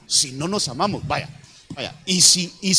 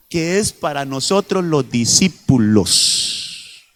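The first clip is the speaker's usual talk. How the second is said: raised-pitch declamation, scripture lines slowed and stretched; emphatic preaching; 4 semitones higher, 5 dB louder than usual. Acted. Preacher reads Jesus's new commandment to the congregation, emphatic preaching. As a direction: proclaim the verses, drawing out the key words. Drives the point home.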